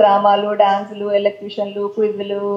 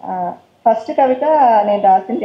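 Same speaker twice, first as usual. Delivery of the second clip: ordinary talk, nothing marked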